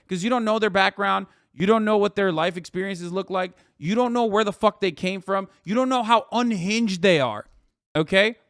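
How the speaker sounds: ordinary talk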